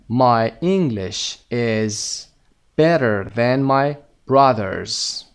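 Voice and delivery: very slow, carefully spaced words; slow model reading. Shown as a demonstration. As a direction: read it out slowly for learners. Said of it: "teacher reads answer slowly".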